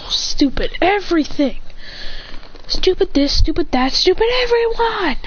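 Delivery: yelling. Acted. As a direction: vent it all out, shouting into the night. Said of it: "as he yells out".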